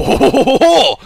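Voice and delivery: loud, high French-accented ho-ho; mock French laugh. Shown as a demonstration. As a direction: guffaw like a caricature Frenchman. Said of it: "That's how you get into a French accent".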